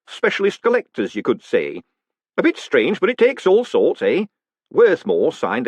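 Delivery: high, loud, chummy executioner; cheery hangman. Acted. Hangman introducing himself to the condemned man, cheerfully. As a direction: greet him like an old friend at work.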